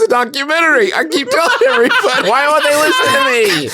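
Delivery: hammed-up conspiracy rant, breaking into laughter; mock doomsayer. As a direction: rave like a laughing doomsday prophet. Mock conspiracy prophet bit.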